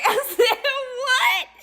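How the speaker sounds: high, loud squeal through laughter; amused, incredulous squeal; laughing disbelief. Retold or imitated. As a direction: shriek it while cracking up. Teller reacts, laughing, to the story.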